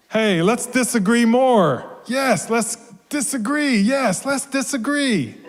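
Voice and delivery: high, excited storybook cheering; gleeful excitement. A narrator's reading. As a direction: cheer it on, pitch climbing. Characters gleefully agree to disagree.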